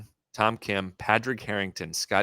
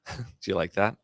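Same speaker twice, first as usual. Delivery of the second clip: normal talking voice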